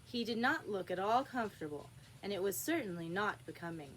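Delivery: ordinary delivery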